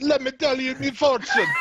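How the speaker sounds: hammy Jamaican fortune teller voice, high; Jamaican accent. Pretend. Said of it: "With a fucking shitty Jamaican accent".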